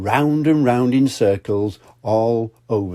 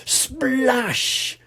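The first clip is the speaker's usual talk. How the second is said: splash. Acted. Sound of monster diving.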